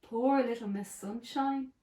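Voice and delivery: ordinary delivery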